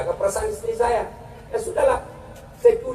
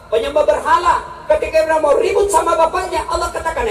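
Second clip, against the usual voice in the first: ordinary talk, nothing marked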